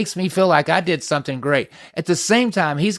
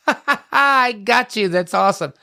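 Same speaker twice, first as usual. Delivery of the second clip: mock gloating laugh; 7 semitones higher than usual. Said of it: "and you're like".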